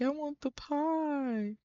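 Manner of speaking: voicing hungry Connor. Voicing Connor wanting pie.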